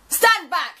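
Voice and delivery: loud, high order; yelled command. Acted. Robin bursting in, yelling at everyone. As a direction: bark it at the room. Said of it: "yelled Robin".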